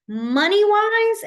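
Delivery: excited, drawn-out money-wise; bright emphasis. Real, not acted. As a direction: lift the word up like a headline.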